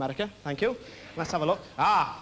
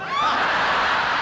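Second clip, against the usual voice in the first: loud laugh; 7 dB louder than usual. Real, not acted. Audience roars, comedian laughs.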